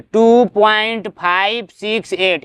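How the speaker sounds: very slow, each of "five six एट" on its own; deliberate slow reading of "five six एट"; digit by digit. Shown as a demonstration. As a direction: stretch and separate "five", "six", "एट" to demonstrate. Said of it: "एक एक करके पढ़ेंगे".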